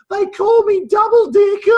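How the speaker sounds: put-on high, loud child's wail, slow. Boy confessing his nickname to his parents, high wail. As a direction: blurt it out sobbing. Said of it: "in floods of tears".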